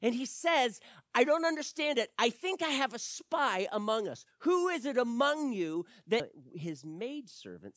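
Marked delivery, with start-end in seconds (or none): 1.1-6.3 loud, high, frustrated royal demand; the king raging, higher and louder. Acted. Angry king demands the spy.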